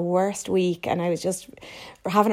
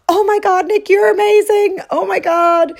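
much higher and louder, bursting with excitement; mum's gushing praise; 13 semitones higher, 8 dB louder than usual. Retold or imitated. Proud mum gushing over her daughter's success, excited and high. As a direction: gush like a proud mum who can't contain herself. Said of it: "She was so proud. She was so excited".

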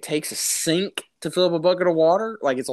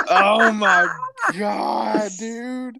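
laughing oh my God at the neighbor; mock horror; 7 semitones higher, much slower than usual. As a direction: squeal it out in laughing disbelief. Horrified at neighbor's news.

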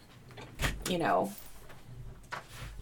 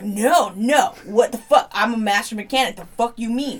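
louder, indignant mechanic's outburst; mock angry mechanic; 3 semitones higher, 7 dB louder than usual. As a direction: play the offended master mechanic. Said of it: "they get mad".

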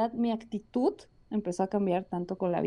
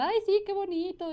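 mock teacher; 11 semitones higher than usual. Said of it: "la maestra nos estaba diciendo".

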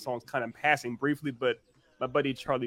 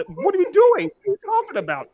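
much higher, louder disbelief; incredulous; 8 dB louder than usual. Real, not acted. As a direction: shout it in disbelief. Incredulous outburst.

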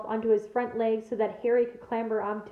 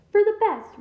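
much higher, louder outraged burst; furious retort; 9 semitones higher, 6 dB louder than usual. A narrator's reading. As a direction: spit it back in disbelief. Bane furious at Firenze.

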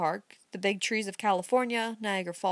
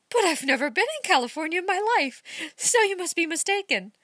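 put-on higher, light young woman's voice; gently insistent young woman, higher voice; Amy's voice; 10 semitones higher, 4 dB louder than usual. A narrator's reading. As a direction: play the puzzled young lady, pitched up. Young woman Amy speaking.

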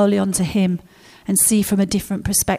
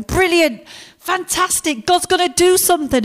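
high, eager, delighted exclamation; excited Moses; 8 semitones higher than usual. Retold or imitated. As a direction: Moses thrilled that God will act, voiced high. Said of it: "And Moses is going".

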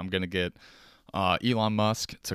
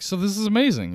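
high, loud gush of excitement; thrilled; 13 semitones higher, 5 dB louder than usual. Acted. Person to uninterested doppelganger, thrilled.

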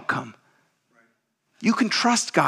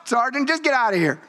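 higher, amused exasperated boss; exasperated chief; 9 semitones higher than usual. Retold or imitated. A lightly voiced chief dismissing the sergeant, amused and fed up. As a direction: brush him off, done with it. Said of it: "Chief's like".

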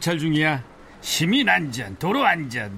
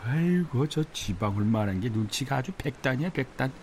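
usual speaking voice

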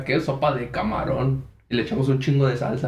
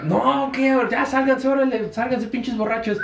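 put-on high scolding old lady throwing them out; angry lady; 10 semitones higher than usual. Lady ordering drunk teens out, high scolding voice. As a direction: shoo the drunks out, voice pitched way up.

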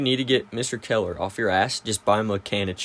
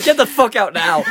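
higher, louder imitated yell; mock yell; 10 semitones higher, 6 dB louder than usual. Friends shouting a classmate out of the hotel room, mock yell. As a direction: bark the order to get out, hammed up. Kicking boy with boner out.

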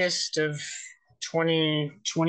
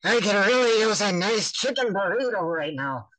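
higher, swooping put-on cartoon voice; party-trick character voice, pitched high; jaw-dropping impression; 5 semitones higher than usual. Shown as a demonstration. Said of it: "The voice that really makes people jaw drop".

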